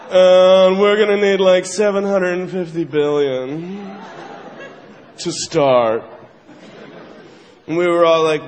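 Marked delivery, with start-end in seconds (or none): 0.1-6.0 low, matter-of-fact ask for billions; smug banker. Acted. Bankers demanding bailout money, low and offhand. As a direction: state the huge sum like pocket change. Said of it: "they're like".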